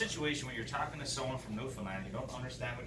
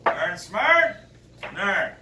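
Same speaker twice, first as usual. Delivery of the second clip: high, loud clipped Newfoundland mumble; terse Newfoundland exchange; Newfoundland fishermen; 7 semitones higher, 14 dB louder, much slower than usual. Acted. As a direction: grunt it like a Newfoundland captain. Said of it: "shortest conversation you're ever gonna hear".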